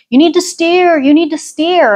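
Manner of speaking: high, loud call across the river; yelling back. Retold or imitated. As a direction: shout the warning from far ahead. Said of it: "were yelling back at us".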